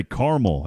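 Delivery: "caramel" as CAR-mul; two-syllable caramel. Shown as a demonstration. Host giving his own habit, "caramel" as CAR-mul. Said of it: "I always say caramel".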